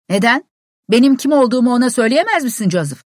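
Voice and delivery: loud, high call up to the window; shouting back. A narrator's reading. Visitor at the locked door shouts up to the servant. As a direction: holler through the snow to be heard.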